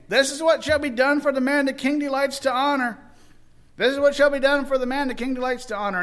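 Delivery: loud, high town-crier proclamation; proclaiming aloud. Retold or imitated. Haman shouting the king's honor through town, loud proclaiming. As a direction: call it out to the whole street.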